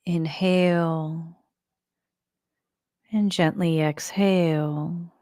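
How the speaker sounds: slow, even meditation voice with long pauses; guided meditation. Acted. Teacher guiding listeners to relax the body and breathe, calm guided meditation. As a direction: lead them calmly through the breath, drawing out phrase ends.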